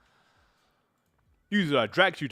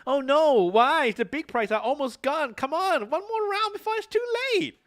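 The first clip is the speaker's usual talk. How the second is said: put-on much higher pleading kid voice; high child voice; 11 semitones higher than usual. Daughter begging for one more round, much higher kid voice. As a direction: squeak out the begging.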